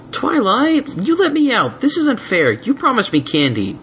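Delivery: high, indignant dragon outburst; raging dragon. A narrator's reading. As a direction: protest it from inside the box. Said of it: "spitting with rage".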